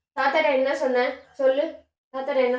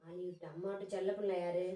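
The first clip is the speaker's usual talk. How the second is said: soft soothing coax; 4 semitones lower, 12 dB quieter than usual. Real, not acted. Mother soothing crying toddler.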